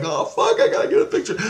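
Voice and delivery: high excited blurt with a laugh; suddenly thrilled to spot it. Retold or imitated. Telling the lizard story, excited blurt.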